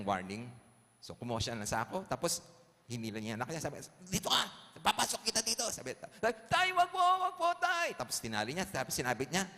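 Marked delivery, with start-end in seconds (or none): 4.0-5.6 loud, high-pitched scolding father; stern father threat. Acted. Father in story threatens son. 6.5-7.9 very high, loud panicked begging; acted shriek of a frightened child; pleading child scream. Child begs father not to.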